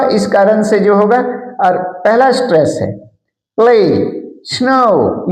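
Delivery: usual speaking voice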